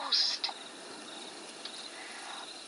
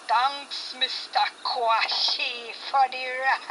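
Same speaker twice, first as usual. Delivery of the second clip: deep masculine ghost voice; slower than usual. A narrator's reading. Ghost rider thanking Mister Kwashi for the ride, eerie and slow. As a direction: thank the man for the ride, then gloat. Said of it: "followed by a deep masculine voice".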